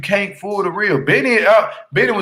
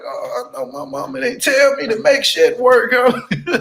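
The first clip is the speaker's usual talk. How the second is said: high, laughing "Oh, I know my mama didn't tell me"; laughing disbelief; 9 semitones higher than usual. Retold or imitated. Telling viewers about her own mama, laughing and disbelieving. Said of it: "I was like".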